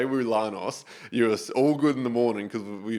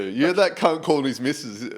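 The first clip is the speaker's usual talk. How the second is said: ordinary talk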